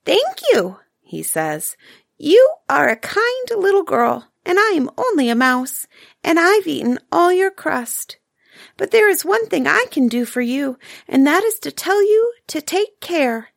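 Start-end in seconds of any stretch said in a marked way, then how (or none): 0.0-0.8 much higher, tiny squeak; a small grateful mouse squeaking thanks; squeaky mouse voice. Acted. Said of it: "in a little squeaky voice". 2.2-13.5 high little mouse, kind and earnest; a tiny mouse giving a friendly warning; small squeaky voice, a bit urgent. Acted. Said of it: "in a little squeaky voice".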